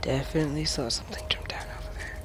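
hushed, quick whisper. Real, not acted. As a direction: keep it to a tense whisper. Whispering while sneaking.

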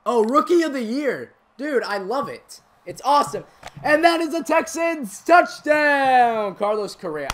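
loud, high shouting, stretched on the big moment; excited touchdown call. Acted. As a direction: celebrate the score like a commentator.

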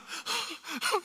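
sharp, high mock gasp; terrified disciple's gasp; frightened gasp. Disciples see a ghost.

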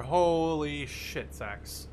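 ordinary delivery

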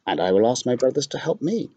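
a bird calling down to soothe Laura. Acted. Bird answers.

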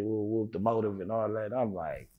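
normal talking voice

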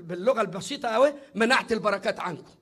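ordinary delivery

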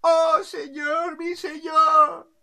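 high, loud, drawn-out acclamation; adoring subjects. Acted. Crowd under the balcony hails the king, high drawn-out acclaim. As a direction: chant it long and high as a crowd. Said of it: "y la gente".

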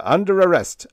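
high, loud arrest order; yelled. A narrator's reading. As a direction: yell it with authority. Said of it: "yelled the commander".

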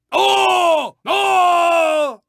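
two high, drawn-out wails; alarmed cries. Acted. Racer losing control on the track, high alarmed wails. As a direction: cry out long and high, twice.

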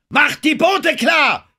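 very high, loud, drawn-out shout; screamed order. A narrator's reading. Denham orders the crew to ready boats, screaming. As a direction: yell the command at full force. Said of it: "schrie er".

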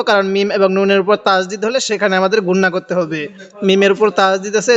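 normal talking voice

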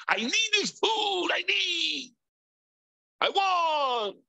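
high, insistent grocery craving; impulsive craving. Retold or imitated. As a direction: whine that you need it now. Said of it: "go into the grocery store and say".

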